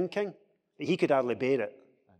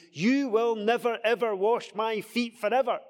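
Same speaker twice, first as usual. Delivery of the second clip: emphatic, raised pitch, louder; strongest refusal; 8 semitones higher, 4 dB louder than usual. Retold or imitated. Peter refusing to let the Lord wash his feet, emphatic. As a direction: refuse absolutely, as if in capital letters. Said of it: "It's the strongest negative".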